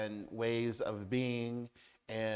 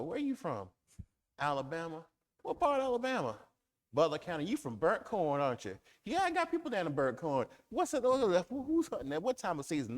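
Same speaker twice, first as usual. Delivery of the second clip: Southern banter; 7 semitones higher, 4 dB louder, faster than usual. Retold or imitated. Acted Southern small talk exchange.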